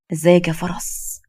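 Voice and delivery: slow, drawn-out suggestive greeting; lazy catcall; leering man. Acted. Man leers at her from behind.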